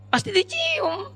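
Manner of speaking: high, slow, drawn-out "of course"; husband's sure answer. Acted. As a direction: stretch out a cheeky, certain reply.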